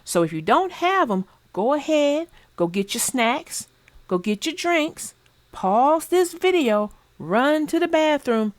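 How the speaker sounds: ordinary delivery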